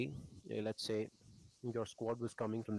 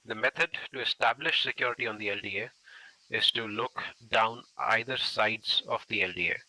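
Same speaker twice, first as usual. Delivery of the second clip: loud voice carried across a road; projecting voice; 7 dB louder than usual. Real, not acted. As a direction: project to the team on the far side.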